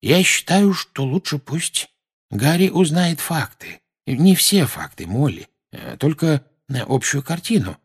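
lightly voiced Lupin, careful and measured; Lupin's voice. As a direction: voice him as calm persuader. Lupin voiced, high character voice.

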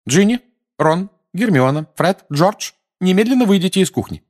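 high, loud, fast order; mother ordering children from the kitchen; mother's command. Acted. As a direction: send the kids out firmly.

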